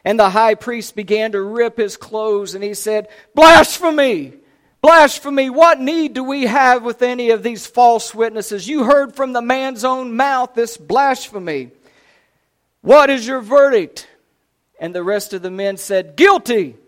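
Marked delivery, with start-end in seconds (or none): 3.3-14.1 high, loud cry of outrage; furious courtroom denunciation, pitched up; outraged shout. Acted. Outraged high priest condemning Jesus. 16.2-16.8 loud, high cry of condemnation; sharp raised shout; shouted verdict. Acted. Council calls out its verdict.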